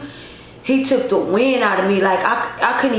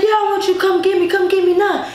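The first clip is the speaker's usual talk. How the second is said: fast, high-pitched plea; imitated tearful pleading; 9 semitones higher, faster than usual. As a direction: act out begging through tears. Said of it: "I was crying".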